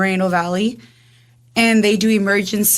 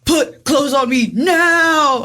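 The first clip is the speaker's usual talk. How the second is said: retold demand, slow and higher and louder; yelling at boyfriend; 7 semitones higher, 4 dB louder, much slower than usual. Ordering boyfriend to dress her, slow raised yell. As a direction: insist on it, yelling, each word drawn out. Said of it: "I'm all yelling at him".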